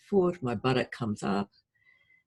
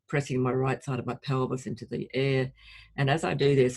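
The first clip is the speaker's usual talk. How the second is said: ordinary delivery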